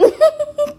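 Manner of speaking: a high, tearful burst of laughter; real high laugh. Real, not acted. Laughing at the memory.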